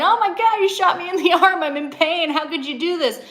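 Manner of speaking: half-hearted acted scream of outrage; mock screaming in pain. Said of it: "you would be screaming and yelling".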